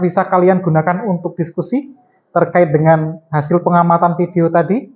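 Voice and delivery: normal talking voice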